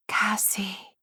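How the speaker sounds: whisper. A narrator's reading. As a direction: ghostly whisper. Ghostly voice calls at night.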